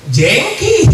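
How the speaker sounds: jengki said as a cutesy lisped jengkol; baby-talk lisp. Retold or imitated. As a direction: squeal jengki high and coy. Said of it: "Nyebut jengkol aja apa engkol?".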